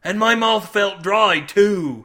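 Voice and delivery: loud, high wail of remembered misery; aggrieved sailor. A narrator's reading. Sailor recalling a terrible headache, anguished. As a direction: exaggerate the awful morning after.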